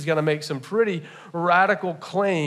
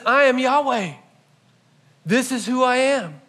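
proclamation; 6 semitones higher, 4 dB louder than usual. Acted. Proclaiming Jesus's claim.

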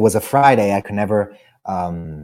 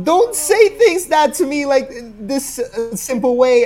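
imitating crying mom; 12 semitones higher than usual. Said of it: "And she starts crying".

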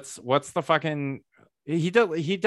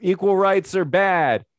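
higher, hammed-up pundit voice pitching hot takes; mock right-wing pundit; 5 semitones higher, slower than usual. Mocking a right-wing guest who wants a podcast spot, hammed-up high pundit voice. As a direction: play a smug culture-war guest angling for a booking. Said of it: "I can shit talk liberals".

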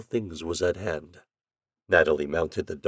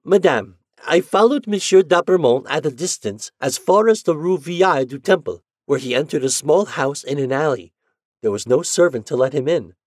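put-on raised, brisk boy's voice; messenger's report; 7 semitones higher, 6 dB louder than usual. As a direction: report dutifully to madame.

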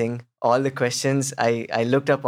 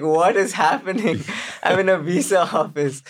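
higher, slow, laughing in disbelief; an applicant can't believe his luck, laughing and higher; 6 semitones higher than usual. Retold or imitated. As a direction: replay your own giddy shock, laughing. Disbelief as officer watches films.

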